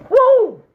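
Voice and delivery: high, loud "woo" to release emotion; emotional whoop. Real, not acted. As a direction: whoop high through the tears. Emotional release while crying.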